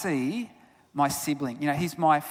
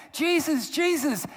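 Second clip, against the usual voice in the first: put-on high calling cry of a running boy; 8 semitones higher than usual. Brother racing down the aisle, high calling out. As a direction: call the name out high as you run.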